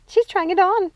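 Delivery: rising delight; excited. Acted. First take.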